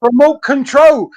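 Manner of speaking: hammed-up boastful dad dragging out each word; proud dad showing off.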